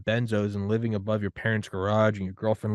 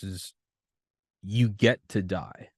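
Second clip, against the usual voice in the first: usual speaking voice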